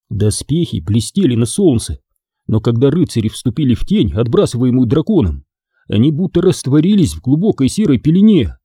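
ordinary delivery

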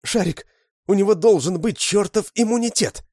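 put-on very high, loud girlish yell; desperate shout. Lyusya screaming at Sharik, very high and loud. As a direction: scream it in despair.

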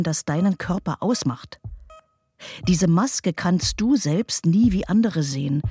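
normal talking voice

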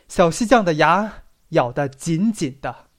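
plain reading voice

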